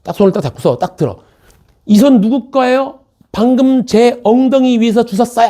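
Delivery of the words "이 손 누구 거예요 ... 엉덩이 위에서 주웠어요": high, raised voice calling out an offender; demonstrated public outcry. Shown as a demonstration. Teacher shows students how to yell at a groper, high outcry. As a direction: shout it so every passenger hears. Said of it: "딱 이렇게 소리를 지르는 거야".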